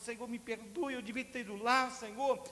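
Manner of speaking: high, loud imitated sobbing prayer; tearful plea. Boy praying in his room, loud and tearful. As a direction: beg forgiveness through tears.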